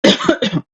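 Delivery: real coughing fit; choking cough. Real, not acted. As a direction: cough on a crumb. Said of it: "I just choked on a corn chip".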